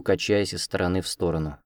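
ordinary delivery, nothing marked

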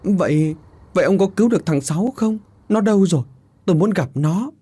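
high, urgent questioning; desperate pleading. A narrator's reading. Mother asking husband about her missing son, desperate pleading.

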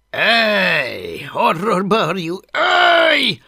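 high, loud call to a pet; shouted command. A narrator's reading. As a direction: bark out a drawn-out order to a beast. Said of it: "adta ki a parancsot halvér".

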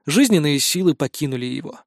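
ordinary delivery

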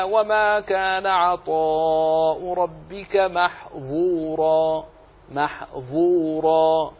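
slow chanted recitation, thick velarized z/dh in "محظورا"; heavy emphatic za. Shown as a demonstration. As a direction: draw out "محظورا" with a full, heavy ظ. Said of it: "هذا فيه إطباق". (ترتيل مع تفخيم الظاء في "محظورا")

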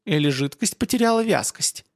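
ordinary delivery

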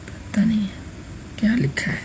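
very soft, slow whisper to oneself; whispered aside. Real, not acted. Teacher unsure what is written, whispering to himself. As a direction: whisper it slowly under the breath. Puzzling over writing to himself.

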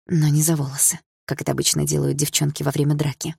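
usual speaking voice

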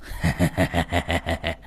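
put-on sinister old man cackle; low eerie laugh; low creepy chuckle. A narrator's reading. Sorcerer laughs before speaking.

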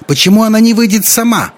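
very high, loud wail; high screeching demand; yelling. A narrator's reading. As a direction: wail a quarrelsome challenge from the crowd. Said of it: "завопила известная своей склочностью Бригитта Таллер".

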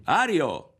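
loud, high call across the pier. Acted. Berto calls up to Ario from the pier. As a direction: hail a friend from below.

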